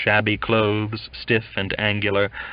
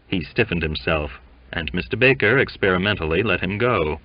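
usual speaking voice

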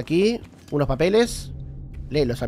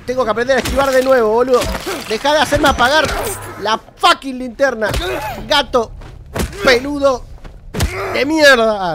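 higher, louder irritated ranting; angry yelling; 8 semitones higher, 6 dB louder than usual. Real, not acted. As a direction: curse the hairy beast. Angry at the monster. (insultos furiosos al gato peludo)

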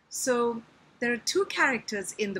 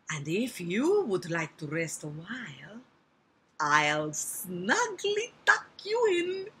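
put-on Italian accent; slower than usual. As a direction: lure sweetly in an Italian accent. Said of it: "I can make him Italian".

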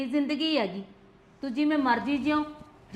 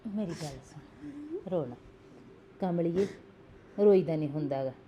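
tender comfort; 7 semitones lower, 4 dB quieter than usual. Acted. Comforting her crying sister-in-law.

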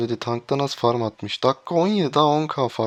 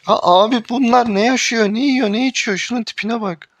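high, loud disbelieving yell; exasperated shout; 10 semitones higher, 7 dB louder than usual. Real, not acted. Gamer raging at teammates' play, high loud disbelief. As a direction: shriek at how bad they are.

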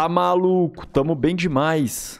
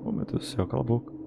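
whispering; 2 semitones lower, 8 dB quieter, much faster than usual. Real, not acted. Hiding from the old man.

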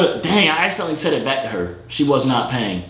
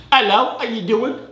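higher-pitched British greeting; put-on British accent; 6 semitones higher than usual. Correcting himself after saying hola, higher British-accented greeting. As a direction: switch brightly into a British hello. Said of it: "I keep doing the wrong accent".

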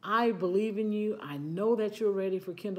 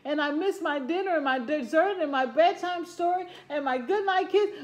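put-on whiny doomed kid; 8 semitones higher, 5 dB louder than usual. Child character lamenting, voiced high.

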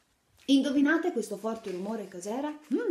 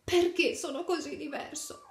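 put-on sad child question; 8 semitones higher than usual. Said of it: "chiese il bambino".

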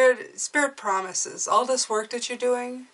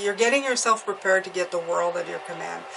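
normal talking voice

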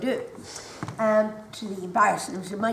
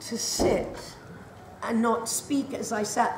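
ordinary delivery

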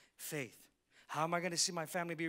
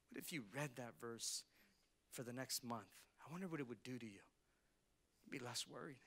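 soft reflective; 4 semitones lower, 7 dB quieter than usual. Real, not acted. Pastor softly urging the congregation to read, quiet tone. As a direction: lean in and speak gently. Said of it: "drops to soft intimate reflection".

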